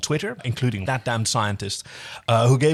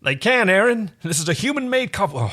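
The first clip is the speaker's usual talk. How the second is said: John voice; 6 semitones higher than usual. Acted. John telling Aeryn the box is human-made, lightly voiced. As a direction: casually explain it to the crew. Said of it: "We're just gonna do some voices".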